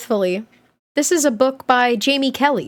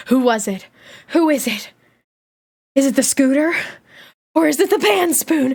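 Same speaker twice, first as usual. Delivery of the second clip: ordinary delivery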